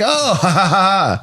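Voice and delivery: hammed-up audience chuckle; mock polite laugh. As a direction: put on a laugh at a joke finally heard. Acting belated laugh at repeated joke.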